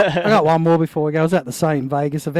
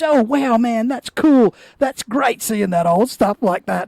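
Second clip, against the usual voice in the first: mildly amazed, higher-pitched imitation; impressed; 6 semitones higher, slower than usual. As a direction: retell his pleased reaction, pitched up. Imitates rider impressed by old photo.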